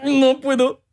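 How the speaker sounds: high put-on skit voice; goofy high-pitched protest; mock refusal. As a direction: refuse in a hammed-up squeak.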